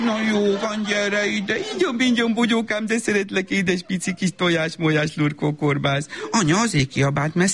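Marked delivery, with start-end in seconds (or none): 0.0-6.1 put-on baby talk. Mother cooing after scolding.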